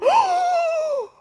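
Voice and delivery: long, loud, high gasp; freaked-out gasp. Real, not acted. Reader finds the very card she needed, astonished gasp. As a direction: gasp hard in amazement. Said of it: "why I'm freaking out".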